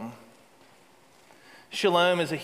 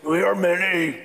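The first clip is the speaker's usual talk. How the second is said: demonic boast; 3 dB louder than usual. Acted. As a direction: boast like something out of a movie from the pits of hell. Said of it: "it's from the pits of hell".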